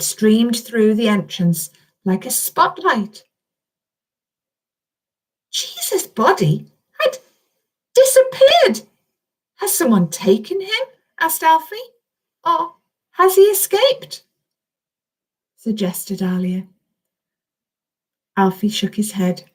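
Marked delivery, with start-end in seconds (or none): 5.5-14.2 a hint of a high, puzzled angel; lightly voiced angel.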